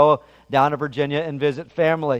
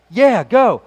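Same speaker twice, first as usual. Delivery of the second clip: raised-pitch mock cheer; 5 semitones higher, 4 dB louder than usual. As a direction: urge her on like a fan at a race. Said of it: "I was cheering my wife on".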